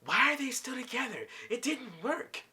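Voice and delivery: high-pitched indignant complaint; mock disapproving whine; imitated hater. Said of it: "there's Pearl hating".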